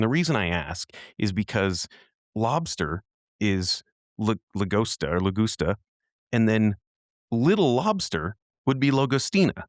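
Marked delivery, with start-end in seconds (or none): none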